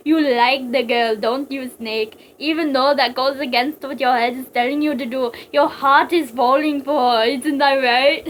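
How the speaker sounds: loud, needling villain voice; theatrical, knowing taunt; taunting psychic villain. Acted. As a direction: goad the hero with relish.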